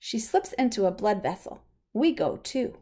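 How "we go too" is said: usual speaking voice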